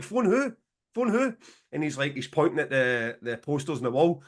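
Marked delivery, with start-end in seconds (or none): 0.0-1.3 high-pitched incredulous retort; mock-baffled, high question. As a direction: retell your bewilderment, pitch leaping up. Retelling his confusion at instruction.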